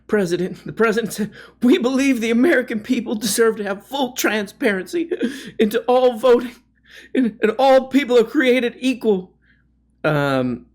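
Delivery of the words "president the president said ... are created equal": mock tearful reading. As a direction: read it like he's welling up with tears. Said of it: "Tim's, like, tearing up while he's reading".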